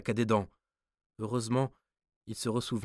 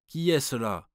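sharp louder challenge, pitched up; guard's cry; 5 semitones higher, 7 dB louder than usual. A narrator's reading. Swiss guard challenges the approaching light, shouting. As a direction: call out into the fog. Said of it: "cria le Suisse".

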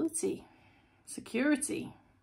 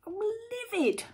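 mock fuming; 9 semitones higher than usual. Tarot reader mocking her own bad mood, hammed-up fuming. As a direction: put on a mock outrage, dragging it out. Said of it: "I've never done a reading when I'm like".

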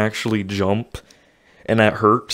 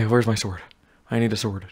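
normal talking voice

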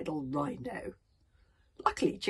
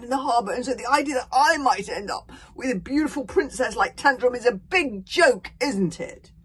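bitter rant; 6 semitones higher, 11 dB louder than usual. A narrator's reading. Fishlegs raging, self-pitying outburst.

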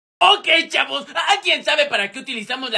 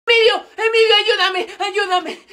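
urgent cry for help; 6 semitones higher than usual. Acted. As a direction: call out to a friend for rescue. Calling a friend for help. (pidiendo auxilio a gritos)

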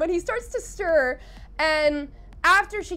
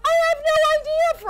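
loud, high-pitched protest of ignorance; flustered exclamation; 14 semitones higher, 7 dB louder than usual. Retold or imitated. Odette answering everyone about the anonymous love letter, flustered. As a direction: burst out high, acting flustered.

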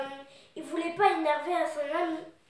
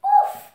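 high, loud puffed-out "ouf"; relieved "ouf"; 14 dB louder than usual. Acted. Oui-Oui relieved his friend isn't angry, high loud "ouf". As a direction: let out a big, high sigh word. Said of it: "oui, dit".

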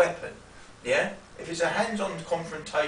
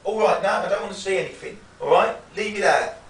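loud, firm "no trouble" with hands up; louder assertive de-escalation; firm refusal; 2 semitones higher, 6 dB louder than usual. Shown as a demonstration. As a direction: set a clear boundary, loud and steady. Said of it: "you say".

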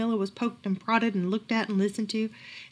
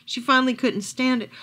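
usual speaking voice

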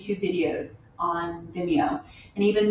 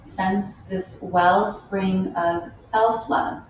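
unhurried meditation guide with long pauses; spacious, measured yoga-teacher delivery; guided meditation. Real, not acted. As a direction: lead them gently inward, phrase by phrase. Leads a loving kindness meditation.

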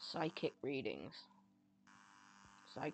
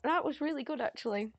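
moved and excited; 5 semitones higher, 4 dB louder than usual. Real, not acted. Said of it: "I've got tears".

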